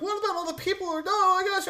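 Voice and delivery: very high, rushed mock fretting; mock panicked objector. Critics answering his limited government idea, high mock panic. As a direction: imitate alarmed objectors in a squeaky-high voice. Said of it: "and they're like".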